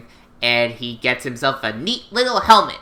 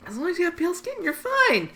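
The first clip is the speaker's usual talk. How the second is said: high mock echo; 4 dB quieter, faster than usual.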